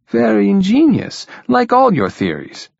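high-pitched mock praise, falling away; mock admiration. A narrator's reading. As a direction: tease with exaggerated admiration. Stormgren dryly teases his colleague's theories.

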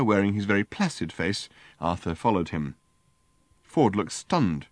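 ordinary delivery

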